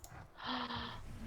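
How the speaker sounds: high, voiceless intake of breath; gasp. Real, not acted. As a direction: gasp in awe at the loot. Amazed at what appears in game.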